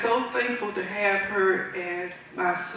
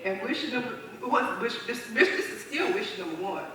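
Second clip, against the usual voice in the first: ordinary delivery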